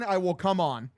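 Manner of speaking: normal talking voice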